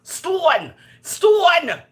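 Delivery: "Stone" pitched high and loud, repeated; emphatic repeat. Real, not acted.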